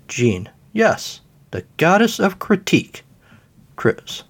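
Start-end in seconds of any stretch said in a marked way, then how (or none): 0.7-3.0 high, proud goddess voice; lofty goddess. Acted. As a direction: announce your divine title grandly. Goddess introduces herself grandly.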